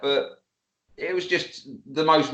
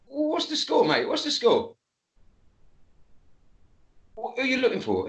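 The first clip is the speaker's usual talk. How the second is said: higher, wary challenge to a stranger; challenging the stranger; 8 semitones higher than usual. Retold or imitated. Man at the door questioned in a higher, wary challenge. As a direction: square up to him, half-joking.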